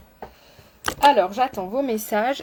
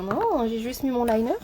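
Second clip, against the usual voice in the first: ordinary talk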